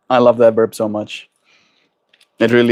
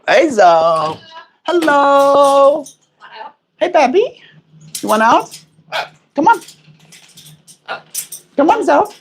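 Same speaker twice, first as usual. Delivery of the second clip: much higher coaxing pet voice; 13 semitones higher than usual. Real, not acted.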